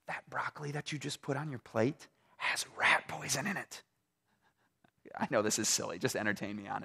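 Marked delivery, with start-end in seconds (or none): 0.1-3.8 secret whisper in the ear; hushed, breathy warning. Retold or imitated. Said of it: "he whispers into your ear".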